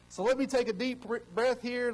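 bursting with excitement before calming down; excited. Real, not acted. Pastor admitting he is too excited to start, keyed-up. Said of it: "get my emotions under control".

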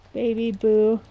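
ordinary talk, nothing marked

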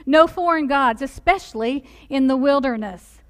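ordinary delivery

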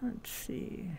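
"Let's see" breathed out as a soft unvoiced whisper. Real, not acted. Streamer whispers while picking an avatar. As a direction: murmur it to yourself, no voice. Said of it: "browsing avatars aloud".

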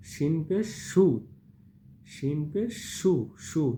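'সু' said as a hushing, airy 'shu'; airy shin sound. Shown as a demonstration. Teacher models shin pesh, pushing air through 'shu'. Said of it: "বাতাস দিতে হবে".

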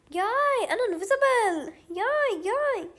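high, loud happy squeals; bright burst of gamer joy; delighted squeal. Real, not acted. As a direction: squeal with glee at turning invisible.

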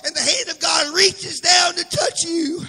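loud preaching, rising in fervour; fiery preaching. Acted. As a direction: preach it out to the whole church. Preaching to the congregation.

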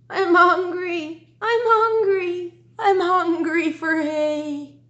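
loud, high, slow whinnying horse; a horse moaning with hunger, drawn out. Acted. Teacher reading to her class, as a loud slow hungry horse. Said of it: "who began to neigh".

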